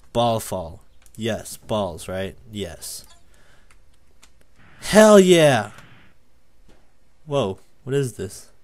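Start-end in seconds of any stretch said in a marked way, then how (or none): 4.8-5.7 high, loud cheer; exultant yell; triumphant shout. Real, not acted. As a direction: burst out in celebration. Succeeds in game.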